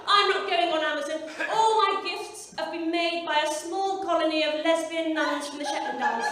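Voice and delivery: loud, mock self-righteous vow; principled declaration. Shopper swearing off Amazon, loud mock-righteous. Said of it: "you start off going".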